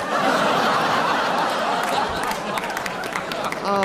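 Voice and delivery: breathless wheezing laughter; long helpless laugh. Real, not acted. Host laughing at the panellist's slip, long breathless laugh. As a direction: collapse into a long silent giggle.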